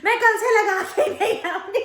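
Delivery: high, loud, slow sulky maid voice with a laugh; mimicking the maid. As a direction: play her petulant comeback, laughing. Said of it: "पता क्या कहती है". (हँसते हुए नौकरानी की ऊँची रूठी नकल)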